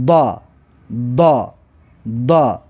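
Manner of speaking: "دا" as a heavy, backed "da"; dad with fatha. Shown as a demonstration. Teacher drills dad with fatha, "دا" as heavy "da". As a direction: say "دا" as emphatic Arabic dad.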